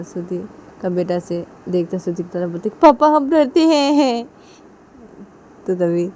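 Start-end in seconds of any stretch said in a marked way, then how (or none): 2.7-4.2 put-on child voice. Son admits fear to father.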